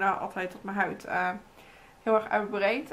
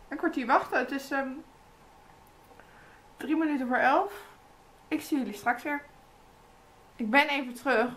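speaking through sheet mask; through a face mask. Real, not acted. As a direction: lips framed by mask opening.

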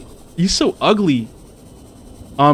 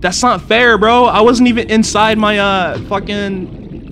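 indignant complaint; 8 semitones higher than usual. Real, not acted. Gamer complaining after creature attack, indignant. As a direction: protest the unfairness.

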